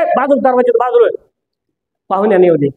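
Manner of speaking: ordinary talk, nothing marked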